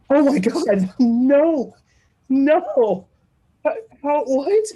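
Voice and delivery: high, loud shocked disbelief; mock horrified. Passengers hearing time of death called, mock horror. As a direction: imitate a whole cabin protesting in alarm.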